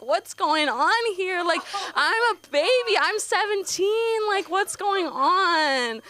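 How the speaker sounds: high, drawn-out mock protest; mock scandalised whine. Teenager shocked by nasty game mods, high mock-scandalised wail. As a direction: stretch every phrase in hammed-up bewilderment.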